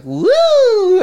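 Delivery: loud, high "woooo"; long high-pitched whoop; high drawn-out woo. Shown as a demonstration. Playful noise while recalling a rating.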